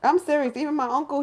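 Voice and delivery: ordinary delivery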